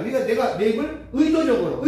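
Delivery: normal talking voice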